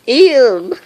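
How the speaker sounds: high loud squeal of "Ew!"; mock disgust. Vlogger squeals at his own runny nose, mock disgust. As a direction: squeal like you're grossed out.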